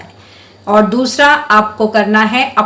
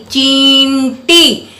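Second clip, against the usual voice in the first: slow, stretched चींटी with a long nasal न; drawn-out word; 3 semitones higher, much slower than usual. Shown as a demonstration. Teacher to children, stretching चींटी slowly to show the nasal. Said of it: "थोड़ा sound को लंबा कर रही हूँ".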